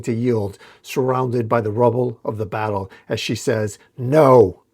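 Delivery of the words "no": short loud refusal; defiant cry. Acted. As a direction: throw out a defiant no. Defiant cry after being hit.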